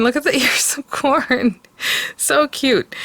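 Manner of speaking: squealing over the cute little pieces; gushing. Real, not acted. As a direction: coo over it with a laugh.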